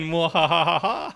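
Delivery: slow evil villain cackle; hammy mwahaha laugh; mad scientist. Pretend. Villain laugh after scheming.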